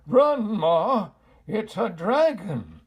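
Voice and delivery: loud, high alarmed shout. A narrator's reading. Pa shouts to Ma to flee the dragon, loud and high. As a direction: yell at Ma to run for her life.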